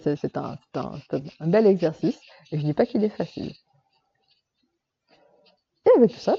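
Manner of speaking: normal talking voice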